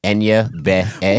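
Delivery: slow, letter-by-letter attempt; drawn-out syllables with gaps between them; sounding it out. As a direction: sound out "Enya Be" haltingly, like a learner reading. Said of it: "What were you sounding out?".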